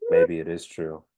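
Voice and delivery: low, soft mock-solemn voice; brooding hero confession. Hero admits to Dick he had a brother, low and hushed. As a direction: confide it quietly, low and halting. Acts Batman after the big battle.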